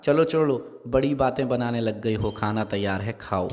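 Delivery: normal talking voice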